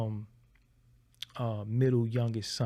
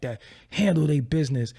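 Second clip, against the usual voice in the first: normal talking voice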